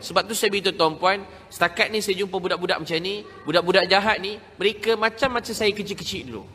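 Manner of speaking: ordinary talk, nothing marked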